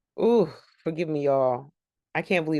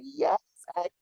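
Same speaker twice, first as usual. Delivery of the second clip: much higher, squealed "Yes, Agnes"; cheering; 10 semitones higher than usual. Retold or imitated. Fan cheering Agnes hugging her sister, high excited squeal. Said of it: "and I was like".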